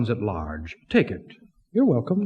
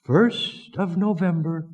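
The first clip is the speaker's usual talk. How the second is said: ordinary delivery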